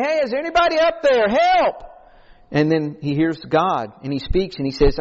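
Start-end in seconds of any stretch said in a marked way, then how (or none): 0.0-1.8 high-pitched imitated call upward; mock plea called out, drawn high; crying out for help. Man hanging off a cliff calls for rescue, crying out. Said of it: "he's crying out for help".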